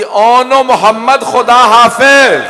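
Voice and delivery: slow anguished shout of goodbye; calling out farewell. Acted. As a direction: call the farewell out across the field. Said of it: "आवाज दी".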